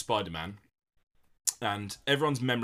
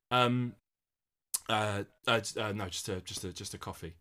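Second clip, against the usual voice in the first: halting, stumbling over every word; hesitant stumbling. Shown as a demonstration. Actor showing how the shy hero should falter. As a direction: trip over the words, losing the nerve. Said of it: "A bit of hesitation in the voice".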